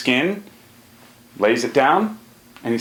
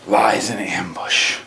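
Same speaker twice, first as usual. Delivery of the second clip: slow hushed whisper; 4 semitones lower, slower than usual. A narrator's reading. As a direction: whisper it like hiding in ambush. Storyteller builds hunting suspense.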